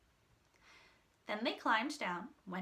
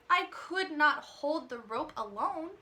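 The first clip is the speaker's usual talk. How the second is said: feigned weakness; 6 semitones higher, 5 dB louder than usual. A narrator's reading. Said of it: "Shang pretended".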